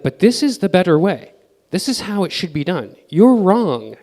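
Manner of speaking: lightly acted stubborn pushback; insistent. Founder arguing with customers about the better way, mock insistent.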